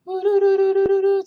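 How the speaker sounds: high, loud falsetto doos. Shown as a demonstration. Coach showing head voice against chest. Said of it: "If you go".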